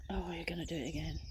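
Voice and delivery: quick, soft grumble; hurried complaint under the breath; muttered exasperation. Real, not acted. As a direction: mutter a resigned aside at the gadget. Muttering at the Kindle again.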